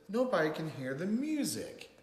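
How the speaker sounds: mildly exasperated storybook parent; scolding. A narrator's reading. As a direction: chide him for spoiling the music. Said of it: "Mama scolded".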